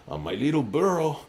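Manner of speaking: lightly put-on movie character voice; movie character impression. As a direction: play the film guy, lilting up on the last phrase. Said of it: "you sound like that guy in".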